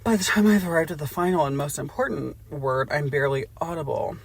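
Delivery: ordinary delivery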